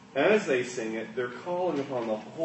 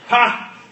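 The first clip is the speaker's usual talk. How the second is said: one sharp loud ha; short laugh; 8 semitones higher, 12 dB louder than usual. Shown as a demonstration. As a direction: bark a single syllable laugh. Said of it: "That's a short laugh".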